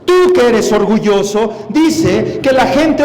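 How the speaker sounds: forceful rebuke. Real, not acted. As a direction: cut off the excuse with a loud, emphatic correction. Preacher rebukes the imitated excuse. (reprensión enérgica, en voz alta)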